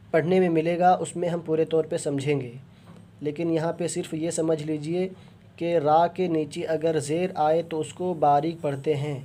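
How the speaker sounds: ordinary talk, nothing marked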